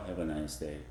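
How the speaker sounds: tender low goodbye; soft and low. Shown as a demonstration. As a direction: say it gently and low. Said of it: "That is quite different".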